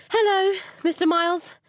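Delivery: breathless, high and loud greeting; out of breath. Acted. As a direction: gasp out a hello, out of breath. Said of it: "her chest heaving with breathlessness".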